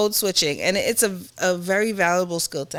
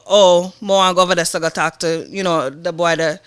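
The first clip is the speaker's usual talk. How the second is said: louder Jamaican patois, speech opening with Oh; Jamaican accent; 2 semitones lower, 3 dB louder than usual. Shown as a demonstration. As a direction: slip into Jamaican speak. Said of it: "It, it's different".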